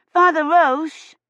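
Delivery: high, loud calling out, slow; child's shout. Acted. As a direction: call across the church to him. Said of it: "Agnes shouted".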